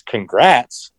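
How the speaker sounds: "Congrats" rising like a question; puzzled "Congrats", lifted at the end. Retold or imitated. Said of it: "like, with a question mark on the end".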